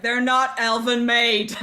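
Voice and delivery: loud, drawn-out shout with a laugh; mock announcement. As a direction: holler it slowly for all to hear.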